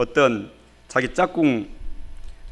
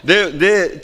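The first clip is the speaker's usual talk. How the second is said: ordinary talk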